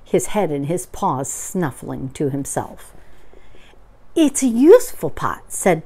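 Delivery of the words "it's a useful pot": high, loud, proud announcement; excited Pooh. Acted. Pooh shows Eeyore the pot, high and excited. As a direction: present it eagerly, pitched up.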